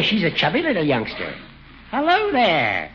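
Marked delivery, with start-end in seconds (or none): 1.9-2.9 high playful cooing; tickly singsong baby talk. Acted. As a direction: coo at a baby, pitched way up. Judge fusses over the baby.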